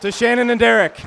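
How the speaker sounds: big shouted cheers to the couple; rousing toast. Real, not acted. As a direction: call out the toast at full volume.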